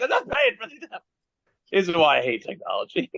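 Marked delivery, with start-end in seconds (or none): 0.0-0.8 high indignant protest; indignant refusal. Retold or imitated. Customer refusing the cashier over missing apple pies, indignant. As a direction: refuse to pay, offended. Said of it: "And I'm like".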